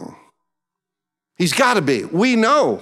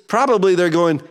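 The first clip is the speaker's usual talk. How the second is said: usual speaking voice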